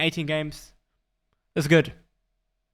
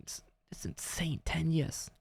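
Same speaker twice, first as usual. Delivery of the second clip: hushed amazed whisper; 13 dB quieter, slower than usual. Real, not acted. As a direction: breathe it out in awe. Awed aside while streaming.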